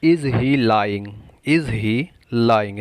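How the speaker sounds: very slow, stretched-out teaching delivery; slow model phrase. Shown as a demonstration. Teacher introduces a new English question, very slowly. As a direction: space out each word for learners.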